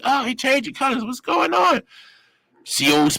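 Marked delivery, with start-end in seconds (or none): 0.0-1.8 high, startled question; alarmed guards. Retold or imitated. Guards watching him change colors, high and alarmed. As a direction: gasp out in alarm. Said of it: "They're like".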